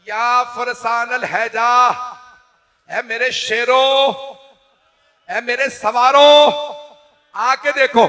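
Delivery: high, loud slow call to distant riders. Acted. Imam calling his fallen riders, high grieving call. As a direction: summon the fallen riders in grief. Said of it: "मौला ने आवाज दी".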